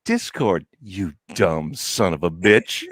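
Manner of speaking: slow, laughing jeer; mock taunting. Husband imitating his wife's taunt, slow and laughing. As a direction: drawl the insult out while laughing. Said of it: "the way she said it too".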